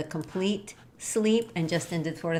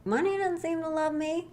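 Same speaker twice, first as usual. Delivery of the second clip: high-pitched inner doubter; small, high voice of self-doubt; 10 semitones higher than usual. Acted. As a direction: voice the mind's objection, pitched way up. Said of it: "that part of your mind's like".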